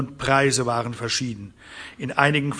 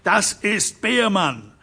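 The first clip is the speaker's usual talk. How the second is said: drawn-out angry cry; shout; 7 semitones higher, much slower than usual. Acted. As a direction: cry out the name slowly. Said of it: "schrie Harron Derrick".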